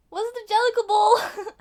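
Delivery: high, loud wide-eyed question; hammed-up high mimicry; Victoria. Victoria asks about the ball, loud and high. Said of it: "And Victoria's like".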